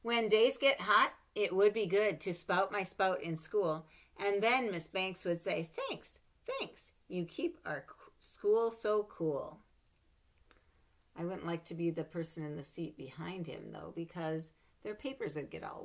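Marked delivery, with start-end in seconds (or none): none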